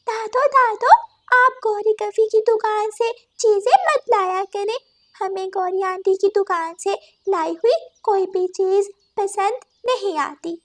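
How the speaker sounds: put-on high, sweet child voice; little child. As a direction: plead like a small kid. Grandchild speaks to grandmother.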